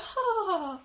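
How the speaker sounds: high, forced mock laughter; an awkward polite laugh; fake laugh. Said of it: "You might even be like".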